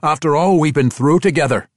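dwarf voice. Acted. Bruenor continues.

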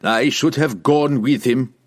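slow, forceful barbarian resentment; growled. A narrator's reading. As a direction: growl it at him, drawing out each word. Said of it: "he growled at Bruner".

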